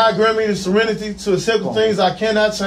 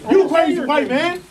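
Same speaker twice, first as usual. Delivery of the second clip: raised upset voice; 7 semitones higher than usual. Real, not acted. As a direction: argue back while restrained. Arguing while being held.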